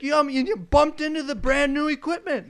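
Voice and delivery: imitated angry foreman. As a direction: pile on another accusation as the boss. Foreman's accusation, retold.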